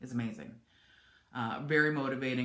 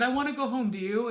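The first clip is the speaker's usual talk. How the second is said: much higher and louder, pleading; emotional plea; 9 semitones higher, 6 dB louder than usual. Retold or imitated. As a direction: plead to go home, close to tears. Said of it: "So I said".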